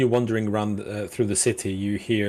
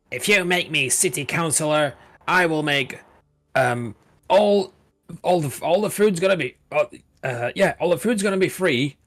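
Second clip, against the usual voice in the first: higher, pompous street speech; soapbox orator; 6 semitones higher than usual. Acted. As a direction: harangue the crowd from a soapbox. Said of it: "he's making a speech".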